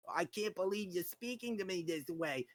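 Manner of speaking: a hint of the offended lawyer's whine; neurotic whiny voice. Lawyer acting wounded at the worker's reply, mildly whiny. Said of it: "The fucking neurotic, whiny, like, voice".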